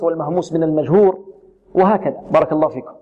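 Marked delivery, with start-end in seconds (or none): none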